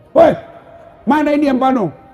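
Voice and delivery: high, loud confronting yell; angry shout. Retold or imitated. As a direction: storm in and demand answers. Husband storms PLN office. (suami marah mendatangi kantor PLN, berteriak tinggi)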